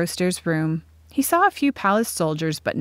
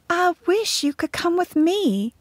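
put-on high-pitched, wistful girl's voice; 11 semitones higher than usual. A narrator's reading. Segra hugs Florette goodbye, wistful. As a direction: speak high and longingly, without pushing. Girl parts from her friend.

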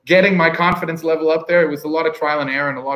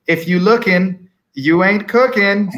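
slow, louder Southern US drawl, pitched up; 5 semitones higher, 4 dB louder, slower than usual. Retold or imitated. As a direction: deliver the pitmaster's maxim in a Southern US drawl. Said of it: "this is my Southern drawl".

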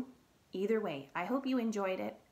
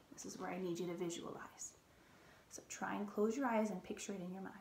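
ordinary delivery

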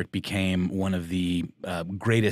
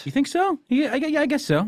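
surprised; 3 dB louder than usual. Real, not acted. Doubtful reply, voice jumps high.

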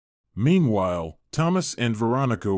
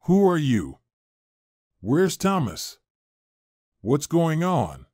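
higher-pitched, understated alarmed demand; mild narrated scream; 5 semitones higher, slower than usual. A narrator's reading. Waking wife confronts a stranger, in an understated, high-pitched scream. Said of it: "and then suddenly screamed".

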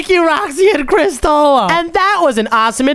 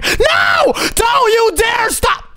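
very high panicked cry; panicked protest; 8 semitones higher, much slower than usual. Real, not acted. Gamer begging the timer not to run out, panicked protest. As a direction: shriek it in desperate protest.

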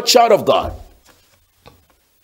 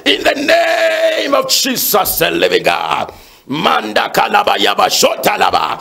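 full-force preaching; 7 semitones higher than usual. Real, not acted. Pastor warning God's children about demons, fervent high-pitched preaching. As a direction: preach it hard, each phrase pushed out and falling away.

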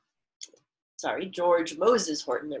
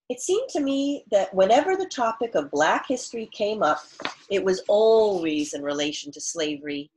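louder, clear read-aloud for the class; reading aloud to children; 3 semitones higher, 5 dB louder than usual. Acted. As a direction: read the author's words to students. Teacher reads author's note aloud.

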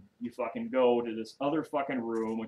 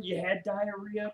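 higher, slow mocking disbelief; ridiculing a guy's bathroom break; incredulous retort; 8 semitones higher, slower than usual. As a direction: stretch it out in mock disbelief. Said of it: "I was like".

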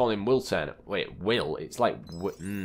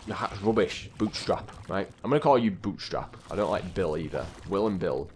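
ordinary delivery

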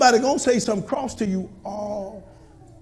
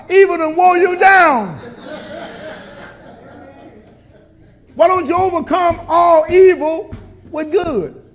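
full-force preaching; 6 semitones higher, 8 dB louder than usual. Acted. Pastor to congregation, fiery preaching. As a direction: rouse the congregation.

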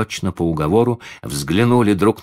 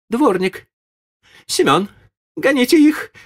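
high, loud furious lady; angry shout; 5 dB louder than usual. Acted. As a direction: scream for the servants in fury. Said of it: "закричала с искажённым от гнева лицом барыня".

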